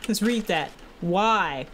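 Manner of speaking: ordinary talk, nothing marked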